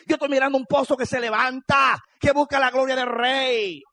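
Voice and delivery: high, loud proclamation with stretched phrase endings; full-force preaching. Real, not acted. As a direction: build to a shouted hallelujah and stay up there.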